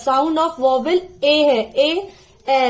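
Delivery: "ए" drawn out slowly and clearly; slow vowel sound. Shown as a demonstration. Teacher demonstrating the vowel sound "ए" slowly. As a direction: stretch the vowel "ए". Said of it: "एड".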